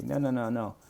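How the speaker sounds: usual speaking voice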